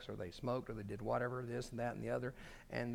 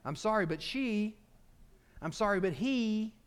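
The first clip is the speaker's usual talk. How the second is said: high, loud, protesting non-apology; defensive mock apology, pitched up; mock excuse-making apology; 7 semitones higher, 6 dB louder than usual. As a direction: hang insistently on the excuse. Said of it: "I'm not gonna start with".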